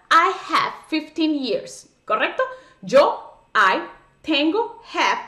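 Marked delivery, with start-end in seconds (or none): 0.1-1.9 slow wrong translation. Shown as a demonstration. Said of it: "traducir eso literal, palabra por palabra".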